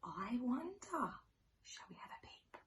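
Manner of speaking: hushed secret whisper. Acted. Teacher to children, whispering before the peep. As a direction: whisper as if peeking.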